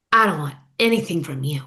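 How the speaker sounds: low, flat snub; cold refusal. Retold or imitated. Tamra snubbing Heather's gumbo offer, low cold refusal.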